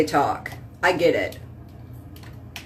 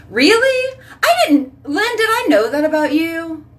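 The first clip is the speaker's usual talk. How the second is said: high-pitched, incredulous surprise; surprised; 9 semitones higher than usual. Real, not acted. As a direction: react to unexpected news in a much higher voice. Reacting to viewer's news.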